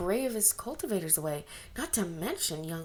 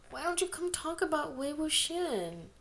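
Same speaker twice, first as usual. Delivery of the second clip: put-on high pleading girl voice; 8 semitones higher than usual. A narrator's reading.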